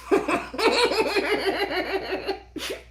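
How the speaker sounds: high, breathy burst of laughter. Real, not acted. Streamer laughing at her own quote, high laugh. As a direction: crack up at the joke.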